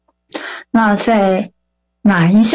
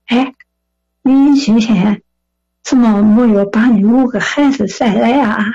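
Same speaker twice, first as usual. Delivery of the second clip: imitated old man from Shandong; slightly raised Shandong-accented voice; Shandong dialect; 2 semitones higher than usual. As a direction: ask teasingly in Shandong dialect. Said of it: "用山东话说".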